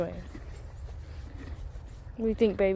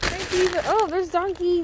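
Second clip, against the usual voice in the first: high, louder pet voice; cooing greeting; 9 semitones higher, 5 dB louder than usual. Real, not acted. Greeting Diva and Donkey in a high cooing voice. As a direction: coo it up high.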